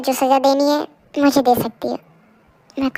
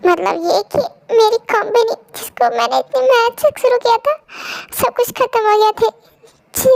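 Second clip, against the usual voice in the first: higher, tearful husband breaking down; crying; 7 semitones higher than usual. Acted. Husband tells wife he lost his company, crying. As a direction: voice cracking as he confesses. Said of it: "रोइए मत".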